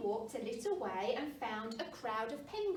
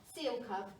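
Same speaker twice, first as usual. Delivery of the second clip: usual speaking voice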